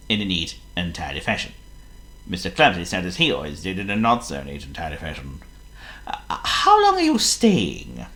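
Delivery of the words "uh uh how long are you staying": high, slow, fretful Fussy voice; a worried host hoping his guest leaves soon; fussy little man. Acted. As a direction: ask it like a nervous neat freak. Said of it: "asked Mister Fussy".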